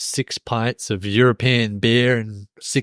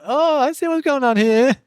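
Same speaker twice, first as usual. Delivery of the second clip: mock intrigued; 13 semitones higher than usual. Teasing a friend about spotting the red-light ladies, high mock intrigue. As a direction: play the curious discovery way up high. Acting out friend's surprised discovery.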